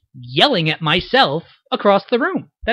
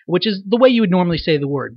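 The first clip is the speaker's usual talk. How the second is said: ordinary talk, nothing marked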